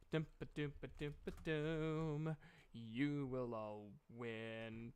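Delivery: slow, soft mock-ominous hum and promise. GM teasing the players about winning, mock ominous. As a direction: tease them like a sinister fortune teller.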